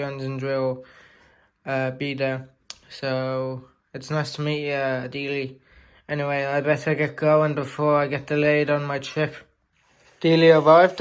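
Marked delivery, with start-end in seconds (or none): none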